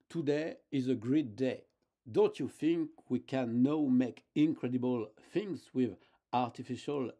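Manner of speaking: loud, exaggerated demo English with a French accent. Shown as a demonstration. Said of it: "moi j'ai un accent anglais qui vaut ce qu'il vaut".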